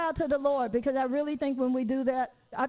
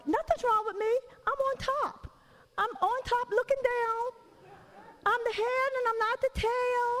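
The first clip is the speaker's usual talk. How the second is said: high, prim holier-than-thou voice; mock super-spiritual; 11 semitones higher than usual. Preacher mocking churchgoers who deny being tired, high and prim. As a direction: send up the proud saint in a high pitch. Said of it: "We're like".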